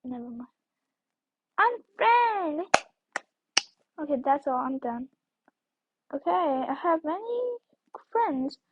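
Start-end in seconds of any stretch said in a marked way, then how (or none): 1.6-2.6 high, drawn-out call; mock-dramatic high announcement; playful exclamation. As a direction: sing out the word high and long. Calling out while blocking a girl.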